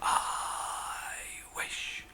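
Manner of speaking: hushed whisper; whispered wish. Acted. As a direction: breathe it in secret. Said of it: "And she said".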